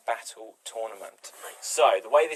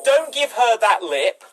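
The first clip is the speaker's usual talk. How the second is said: higher, louder, slow telling-off; scolding; 10 semitones higher, 8 dB louder, slower than usual. Pretend. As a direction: tell them off sharply. Improv scolding another shopper.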